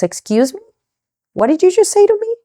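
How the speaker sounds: mock-outraged mom, pitch leaping up; incredulous, high-pitched parental rebuke; indignant mom. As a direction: act out an affronted mother's challenge. Mom reacts to quitting announcement.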